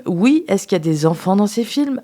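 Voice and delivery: ordinary talk, nothing marked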